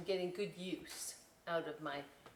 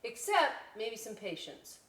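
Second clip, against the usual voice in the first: ordinary delivery, nothing marked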